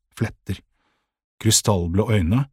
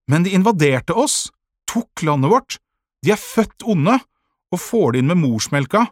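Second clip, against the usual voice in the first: high, loud indignation; outraged accusation; heated protest; 8 semitones higher, 5 dB louder than usual. A narrator's reading. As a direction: argue back hotly, pitch raised. Boy argues heatedly against the enemy.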